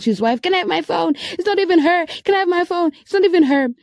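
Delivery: high, pleading imitation of a husband behind the caller; man in the background. Husband asking his wife for his phone, background voice. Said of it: "this guy's voice at the back".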